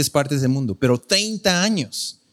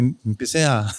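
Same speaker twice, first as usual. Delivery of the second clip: ordinary delivery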